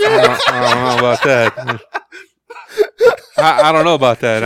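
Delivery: laughing. Real, not acted. Laughing denial.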